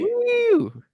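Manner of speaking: high, loud cheer; excited whoop. Real, not acted. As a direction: let out a celebratory woo. Cheering the idol play.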